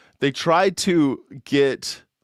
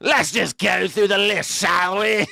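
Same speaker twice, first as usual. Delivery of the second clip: high, slow posh English mimicry; mock English accent; 8 semitones higher, 3 dB louder, slower than usual. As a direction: echo the pundit in plummy English. Mocking English TV guest.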